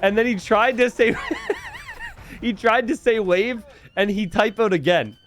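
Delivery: high, laughter-filled retelling; laughing while talking. Real, not acted. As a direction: talk through giggles about the typo. Laughing about Red's typos.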